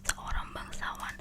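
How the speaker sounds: ordinary delivery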